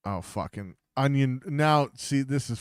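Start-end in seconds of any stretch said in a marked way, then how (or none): none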